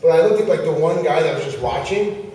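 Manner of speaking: ordinary talk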